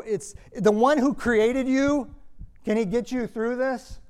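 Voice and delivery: high, loud and slow, leaning on one; impassioned preaching. Real, not acted. Preacher urging the congregation, impassioned and loud. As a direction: proclaim it to the congregation.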